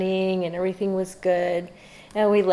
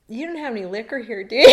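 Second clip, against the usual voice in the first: laughing retold plea; 4 semitones higher, 10 dB louder, faster than usual. Retelling asking Rosie for liquor when soaked, breaking into laughter. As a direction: question tipping into laughter.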